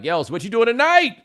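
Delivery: playful flirting bravado; eager pickup line. Retold or imitated. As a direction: sell the question like a smooth talker. Smooth pickup line in story.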